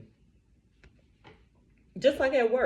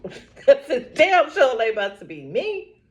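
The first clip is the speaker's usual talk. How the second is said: slow, louder laughing brag; laughing defiance; 4 semitones higher, 7 dB louder than usual. Real, not acted. Laughing that the slap won't land on her, laughing.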